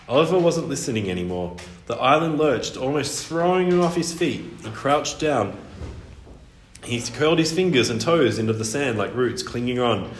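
ordinary delivery

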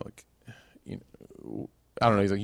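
ordinary talk

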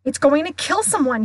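shout. A narrator's reading. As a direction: cry out the danger while running. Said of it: "he shouted".

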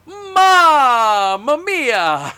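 very slow, loud, high drawn-out cry; mock Italian exclamation. Podcaster imitating the commentator's catchphrase, slow loud mock cry. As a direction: stretch it out like a hammy commentator. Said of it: "'Cause he can just go".

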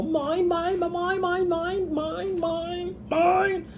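very high squawking seagull voice; Finding Nemo seagulls. Retold or imitated. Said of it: "Very much like the seagulls from, uh, was it Finding Nemo".

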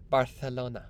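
Barcelona with a lisped c, Barthelona. Shown as a demonstration. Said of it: "You gotta say it with a lisp".

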